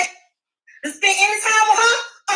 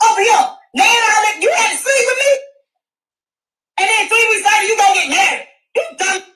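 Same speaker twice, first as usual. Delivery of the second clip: loud, high angry rant; 4 semitones higher, 3 dB louder than usual. Real, not acted. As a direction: let it out at her. Ranting at daughter, louder.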